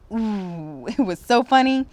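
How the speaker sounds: laughing loudly through the line. Acted. Narrator recalling the teacher's fall, laughing out loud. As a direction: let the giggle spill into the words.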